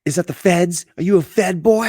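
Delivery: high-pitched hammed-up interrogation; high, put-on suspicious questioning; mock suspicious bystander. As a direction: play a local accusing someone in a high voice.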